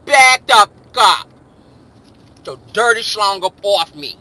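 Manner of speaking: loud, forceful, lips pulled tight; tight-lipped holler. Shown as a demonstration. So he knows you mean it, tight-lipped holler. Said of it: "gotta say it with your lips tight".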